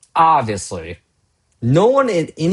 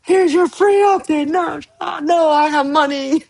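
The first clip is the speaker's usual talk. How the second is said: high-pitched hammed-up meme voice; high mock-panic voice. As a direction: shriek it like a cornered fan, laughing. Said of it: "you know".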